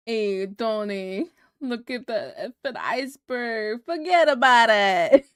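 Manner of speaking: hammed-up Jersey mobster voice; mock New Jersey accent. Parodying Titanic as a New Jersey tough guy, brash and loud. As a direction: play Jack as a Jersey wiseguy, loud at the end. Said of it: "Jersey broads".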